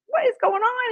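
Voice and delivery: high-pitched bewildered question; retold disbelief. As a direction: replay catching Mom in the act. Disbelief at mother flirting.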